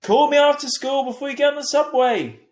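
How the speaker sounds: high, loud call from the window; yelled. A narrator's reading. Mum yelling from the window to Via, loud and high. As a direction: yell it down to the street. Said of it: "Mum yelled at me".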